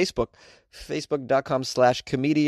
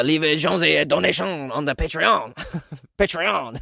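put-on French accent; 3 semitones higher than usual. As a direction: leave a Jonesy a donation on the Patreon in a hammed-up French accent. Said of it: "a good word to say with a French accent".

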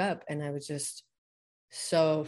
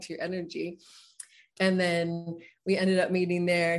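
ordinary talk, nothing marked

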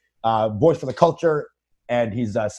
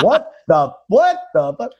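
pitched-up baffled protest; exaggerated "how dare you" disbelief; mock disbelief; 7 semitones higher, slower than usual. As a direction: react in high, acted bewilderment. Acting his bewildered reaction to accusers.

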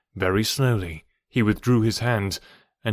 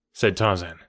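usual speaking voice

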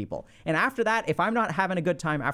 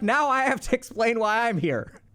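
higher, mock exasperated whine; mock put-upon guest; 4 semitones higher than usual. Socially anxious guest forced to explain himself, mock whine. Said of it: "They're like".

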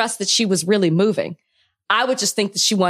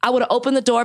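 ordinary talk, nothing marked

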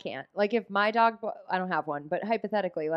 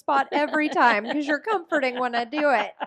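lightly voiced dog, higher, through laughter; pretend dog; 6 semitones higher than usual. Joking as the dog who enjoys being comforted, playful higher voice. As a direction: pitch it up as a scheming pet.

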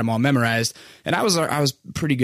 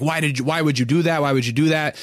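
usual speaking voice

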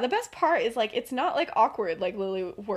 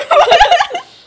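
sudden squealing laugh; high shriek of laughter; loud high laugh; 16 dB louder than usual. Real, not acted. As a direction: burst out laughing. Laughing at own joke.

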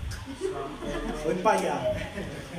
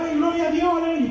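higher, mild mock wail of praise; imitated tearful cry; 6 semitones higher than usual. Preacher imitating his wife praising God at news, mild mock crying. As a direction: lightly act out a wife's overjoyed cry. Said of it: "mi esposa empezó a gritar y a llorar".

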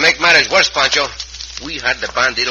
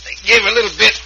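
higher, louder with effort; straining effort; 10 semitones higher, 5 dB louder than usual. Acted. Cisco bending the jail bars, straining loud and high.

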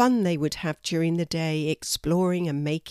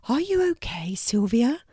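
concerned whisper; 5 semitones higher than usual. A narrator's reading. Trudy concerned for Sylvia.

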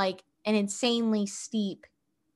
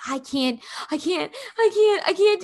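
high-pitched imitation of a panicked, tearful child; mock crying; 9 semitones higher than usual. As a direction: act out a kid crying in panic. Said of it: "like, crying".

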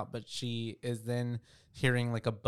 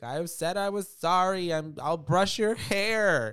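loud, high, drawn-out mock yell; imitated yelling; 8 semitones higher, 7 dB louder, slower than usual. Imitating Lily yelling on the other side of the door, loud and high. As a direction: holler it slowly like a child through a door. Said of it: "like, yelling on the other side".

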